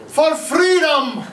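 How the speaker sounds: high, slow, shouted Scottish rallying cry; Braveheart battle cry. Pretend. Teacher shouts the Braveheart line on his students' cue, slow and high. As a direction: bellow it like a highlander charging into battle. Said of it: "thank you for cueing me on that".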